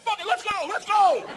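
high-pitched psyched yell; hammed-up gung-ho holler; pumped-up bravado. Drunk bravado acted out.

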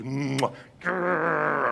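smacked kiss, then playful growl; dad's kiss and rolled growl; playful affection noises. Acted. Acts father kissing, roughhousing son.

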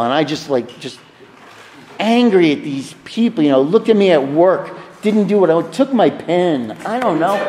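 ordinary talk

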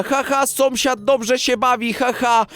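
normal talking voice

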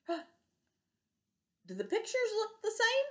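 high, slow amazed question; gasp of wonder. Real, not acted. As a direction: gasp and marvel at the pictures. Teacher gasps, marvelling to kids.

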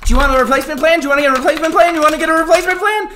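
nagging salesperson imitation; mocking pushy clerk. Mimicking clerks pushing plans.

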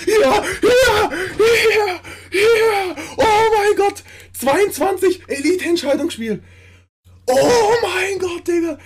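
high, loud shouts of disbelief; ecstatic victory scream. Real, not acted. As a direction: scream your joy at the win, near tears. Victory scream after winning.

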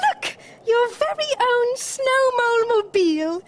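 high, loud excited squeak; high-pitched, thrilled little creatures; squeaky mice. A narrator's reading. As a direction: present the gift in tiny mouse voices. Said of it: "squeaked the mice".

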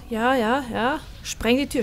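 usual speaking voice

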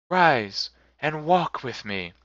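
a gentle ghost, slow and grave. A narrator's reading. Spirit answers Scrooge, lightly voiced ghost. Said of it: "as it spoke".

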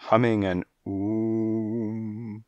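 slow hummed oom like a diva; theatrical oom. A narrator's reading. Fortune-teller humming into her trance, slow diva hum. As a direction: hum it grandly. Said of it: "she did it theatrically".

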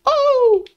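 high-pitched drawn-out hoot. Real, not acted. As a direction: cry out high in surprise. Reacts to the game.

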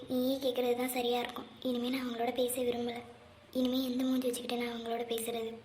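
hushed, close inner thought. Acted. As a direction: think it silently to yourself. Private thoughts, giving up on him.

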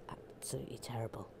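low, quick whispered disapproval; whispered aside. Pretend. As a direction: whisper it under the report. Hushed aside during mock report.

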